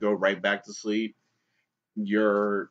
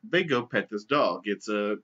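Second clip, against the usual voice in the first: normal talking voice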